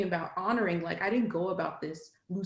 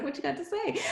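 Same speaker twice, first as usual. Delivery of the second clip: relaxed challenge; 9 semitones higher, 5 dB quieter, faster than usual. Retold or imitated. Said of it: "shoulders relaxed, not defensive".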